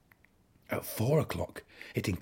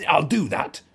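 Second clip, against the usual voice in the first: high, loud screech; 9 semitones higher, 9 dB louder than usual. A narrator's reading. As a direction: screech it out in a huff. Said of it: "screeched the bad-tempered ladybird".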